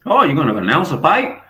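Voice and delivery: high, loud put-on voice; hammed-up excited question; high loud mock reporter; mock interviewer. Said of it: "He said".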